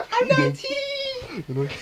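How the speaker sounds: squealing high while being tickled. Real, not acted. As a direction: shriek it out through tickling laughter. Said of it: "are you tickling him?".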